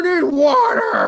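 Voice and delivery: high, loud, slow drawn-out yell; charging the guy, bellowing water offer; battle-cry shout. Acted. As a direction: scream it as you leap on him. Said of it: "I'm gonna go".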